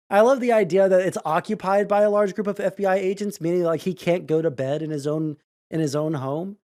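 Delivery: ordinary delivery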